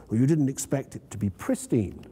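ordinary delivery, nothing marked

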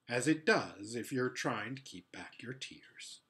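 ordinary delivery

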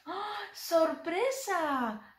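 high, drawn-out "Sorpresa!"; mock surprise. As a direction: play the big reveal for a toddler. Lift-the-flap reveal for child.

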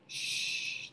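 long unvoiced "shh"; hushing someone sharply; imitated shushing. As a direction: shush like an embarrassed cousin. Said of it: "my cousin's just like".

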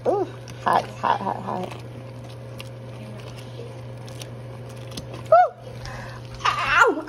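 yelping at heat. Real, not acted. Burning herself on hot peanuts.

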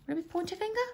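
high rising unsure question. Real, not acted. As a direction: ask it, genuinely unsure. Unsure which finger to name.

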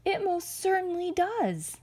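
very high, anxious exclamation; high-pitched bear alarm; gasped alarm. A narrator's reading. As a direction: gasp it out in rising panic. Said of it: "gasped the bear".

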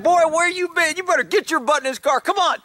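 playfully telling off a runaway dog; scolding dog. Retold or imitated. Scolding the dog back out of the bushes, mock scold.

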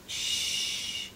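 usual speaking voice

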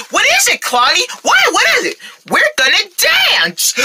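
put-on high-pitched, bursting kid voices; eager excitement. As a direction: build up to a thrilling reveal. Kids excited about new plan.

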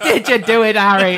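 put-on loud, high Irish accent through laughter; hammed-up Irish, half laughing; mock Irish accent. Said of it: "Why are you Irish?".

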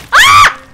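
piercing shriek that breaks into a laugh; scream, then laugh it off; startled scream. Real, not acted. Streamer jolted by a scare, shrieking then laughing.

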